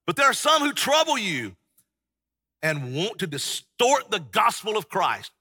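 preacher voicing Paul's passionate protest. Shown as a demonstration. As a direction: pound it home with fervour. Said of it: "He's probably getting very passionate".

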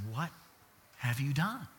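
very soft, halting near-whisper; barely voiced, haunting reproach; God's searching question. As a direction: ask it hushed, with a pause after the first word. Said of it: "it's as if God looks at us and says".

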